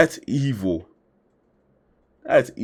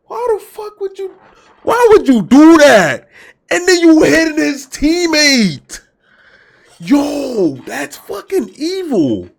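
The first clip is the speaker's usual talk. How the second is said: high, loud, indignant shout; outraged on the husband's behalf; outraged yell; 12 semitones higher, 7 dB louder than usual. Real, not acted. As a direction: demand an answer, furious. Outraged at cheating wife.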